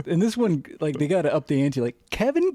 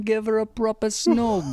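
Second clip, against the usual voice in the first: mock British accent; 7 semitones higher than usual. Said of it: "work the word snog".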